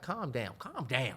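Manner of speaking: cat calming its owner, softly. Pretend. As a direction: soothe him gently. Cat voiced soothing him.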